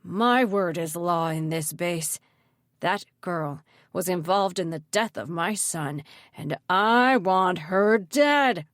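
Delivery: slow, heavy speech building to a loud finish; menacing anger. A narrator's reading. Mayor demands death for his son's sake, slow fury. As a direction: drag out the words, swelling to a furious end.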